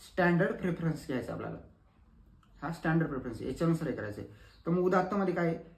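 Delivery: normal talking voice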